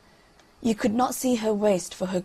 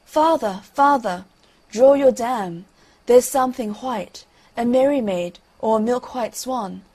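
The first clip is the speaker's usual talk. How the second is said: loud, urgent call to father; raised storybook cry of alarm; 3 semitones higher, 7 dB louder than usual. A narrator's reading. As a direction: call out louder and higher, easing as it goes on. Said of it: "she cried out".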